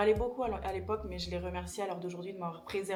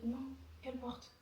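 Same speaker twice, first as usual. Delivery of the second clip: scared whisper; 4 semitones higher, 6 dB quieter than usual. Real, not acted. Scared whispered phone call.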